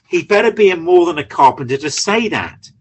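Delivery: usual speaking voice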